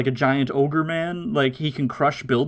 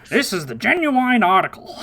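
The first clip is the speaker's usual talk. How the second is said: higher-pitched cartoon character impression; Oolong; 7 semitones higher than usual. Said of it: "I also like Oolong's, like, actual voice".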